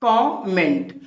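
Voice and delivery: कमेंट drawn out as KAW-MENT; syllable breakdown. Shown as a demonstration. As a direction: stretch कमेंट into two parts, KAW and MENT. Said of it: "background करके यहाँ पे क्या पढ़ेंगे?". (कमेंट को "कॉ-मेंट" तोड़कर बोलें)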